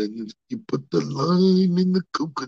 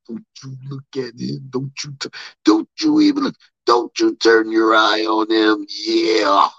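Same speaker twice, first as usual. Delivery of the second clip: jealous warning; 4 semitones lower, 5 dB louder than usual. Retold or imitated. Macho Man warning Miss Elizabeth in a loud, jealous voice. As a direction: warn her off hard, leaning on each "don't". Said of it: "Just like I told Miss Elizabeth".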